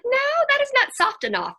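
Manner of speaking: mock-alarmed veto to a kid; emphatic refusal. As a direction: swoop up high on the no. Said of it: "I was like".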